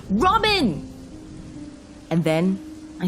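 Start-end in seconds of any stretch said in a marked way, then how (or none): 0.1-0.9 high, loud cry of a name; desperate shout. A narrator's reading. Driver shouts over the officer, desperate. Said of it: "I shouted over him".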